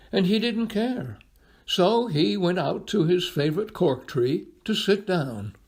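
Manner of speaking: plain reading voice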